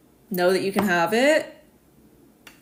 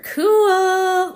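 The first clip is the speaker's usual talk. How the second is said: excited cool; 12 semitones higher, 8 dB louder than usual. Real, not acted. Reacting to viewer's chart.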